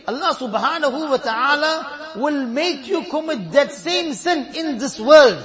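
loud, slow warning on the last words; forceful preaching warning. Real, not acted. Preacher warning the congregation about spreading faults, loud and slow. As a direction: drive the warning home.

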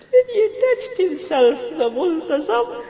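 very high, loud tearful cry; weeping wail. Real, not acted. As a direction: let it break into a high wail. Repeats it, overcome by weeping.

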